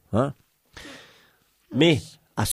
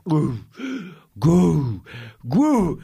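mock retching hiccups; hoquets imités; 3 semitones higher, much slower than usual. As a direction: gulp out separate hiccups before being sick. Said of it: "elle a des hoquets comme ça". (gros hoquets de nausée imités)

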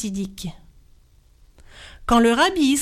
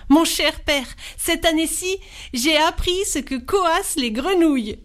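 eager son; 6 semitones higher than usual. A narrator's reading. Son proudly answers father.

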